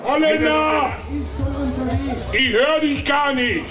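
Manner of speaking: lauter Zuruf. Real, not acted. Marktschreier ruft Konkurrenten zu.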